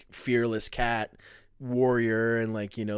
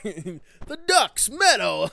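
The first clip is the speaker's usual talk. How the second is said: 1 laughing Tony Soprano; 9 semitones higher, 8 dB louder, much slower than usual. Pretend. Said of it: "do a Tony Soprano impression".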